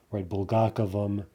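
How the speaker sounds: ordinary delivery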